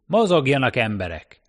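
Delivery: loud, high start, easing on the last word; foreman's shout. A narrator's reading. Foreman driving workers out of the lift, shouting. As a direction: get the crew moving. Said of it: "kiabált a munkavezető".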